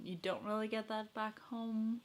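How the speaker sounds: ordinary talk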